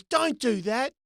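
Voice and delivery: imitating a father's weak telling-off; dad protesting. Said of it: "he goes".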